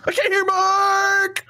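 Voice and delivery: very high, drawn-out mock wail; whining fake fan. Co-host imitating viewers who can't hear Mark, high whine. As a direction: whine it slowly like a clueless viewer. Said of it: "fake fans who are just here like".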